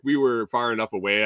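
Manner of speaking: ordinary talk, nothing marked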